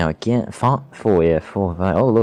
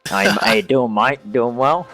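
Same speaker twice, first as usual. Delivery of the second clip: a higher, played-up Australian accent on "mate"; hammed-up Aussie greeting; 5 semitones higher, slower than usual. Greeting fellow Aussie Reese, in a hammed-up Australian accent. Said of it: "so good to hear an Aussie accent".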